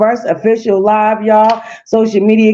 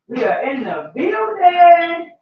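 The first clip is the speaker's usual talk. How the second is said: high, slow, drawn-out hype call; stretched-out entrance shout; hype announcement; 9 semitones higher, slower than usual. Real, not acted. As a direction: announce your arrival like a host hyping the crowd. Hype entrance on live stream.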